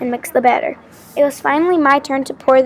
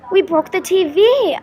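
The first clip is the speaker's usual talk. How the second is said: dismayed yelp; 5 semitones higher than usual. A narrator's reading. Girl to friend after the ball hits the TV, yelping. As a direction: yelp it as the damage sinks in. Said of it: "I yelped".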